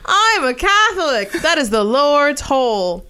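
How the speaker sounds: high, loud, slow mock-solemn line; mock pious voice. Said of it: "continuing the mock stand-up bit".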